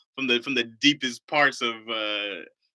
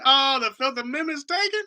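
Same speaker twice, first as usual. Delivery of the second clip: surprised. Acted. Reading a chat comment incredulously.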